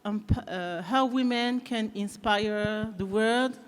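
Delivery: English with a light French accent; French-accented English, lightly done; slight French accent. Real, not acted. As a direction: speak English as a native French speaker would. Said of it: "avec un petit accent français".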